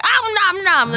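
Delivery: loud, high cartoon munching; exaggerated greedy nom-noms; eating noise. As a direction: gobble noisily through the words. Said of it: "You gotta tuck yourself through it".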